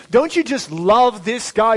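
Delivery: ordinary talk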